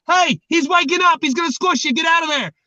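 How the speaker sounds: high-pitched warning called from afar; lightly acted alarm, calling across the yard; mock urgent call. Mouse calls warning from yards away.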